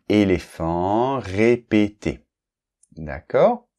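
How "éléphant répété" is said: « éléphant » et « répété » articulés lentement, chaque é bien fermé; détachez les syllabes de « éléphant », « répété », lentement; exemple de prononciation. Shown as a demonstration. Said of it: "Donc, par exemple". (prononciation lente et appliquée pour les élèves)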